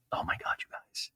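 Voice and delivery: soft unvoiced whisper; gossiping host whispering in shock; stage whisper. Real, not acted. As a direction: lean in and whisper to the audience. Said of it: "whispered aside to audience".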